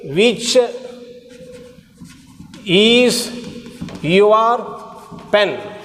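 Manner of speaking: word by word with long gaps; slow dictation. Shown as a demonstration. Teacher dictating the question example to students, word by word. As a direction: spell the sentence out slowly for the class. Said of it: "Example, look here".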